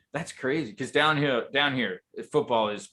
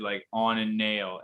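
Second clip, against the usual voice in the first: normal talking voice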